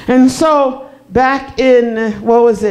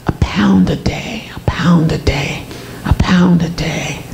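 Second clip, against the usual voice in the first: lower, whispered through tears; tearful whisper; 6 semitones lower than usual. Real, not acted. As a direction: whisper it, near crying. Weight loss while ill, crying.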